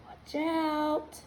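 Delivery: hushed, high playful warning. Acted. Reading to kids, soft teasing warning.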